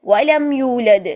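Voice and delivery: slow, drawn-out Quran recitation. Shown as a demonstration. Teacher reciting Surah Ikhlas as an example. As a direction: recite with the dal echoing. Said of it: "پھر سے دال ہلا".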